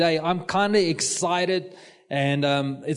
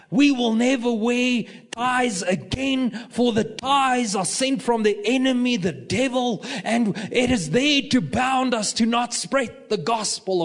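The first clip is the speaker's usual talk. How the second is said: fired-up preacher impression; 7 semitones higher than usual. Preacher renouncing ties before his church, mock-fiery proclamation. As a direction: declaim it like an overwrought preacher.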